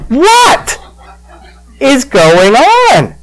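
very high, drawn-out incredulous question; acted bewilderment. Preacher asking himself about his laughing fit, mock-baffled squeal. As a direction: squeal WHAT, then stretch out "is going on".

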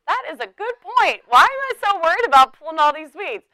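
a touch of amused surprise; mock realization. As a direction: act struck by a new idea. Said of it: "And I was like".

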